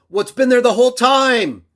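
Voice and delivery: sudden high, loud outburst; angry shout. Real, not acted. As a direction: blow up on the last words. Rant peaks.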